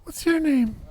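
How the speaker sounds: high falsetto lady voice, a man in disguise; fake lady voice. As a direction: squeeze it up into a fake woman's voice. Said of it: "Disguise your voice like a lady?".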